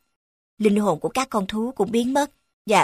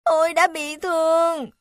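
whining; 7 semitones higher than usual. Acted. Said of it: "ả rên rỉ".

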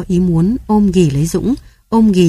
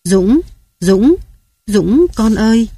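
narrated mother's tearful cry, raised slightly; understated anguish, each name lifting at the end; mild sobbing call; 4 semitones higher, slower than usual. A narrator's reading. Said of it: "vừa nức nở kêu tên Dũng".

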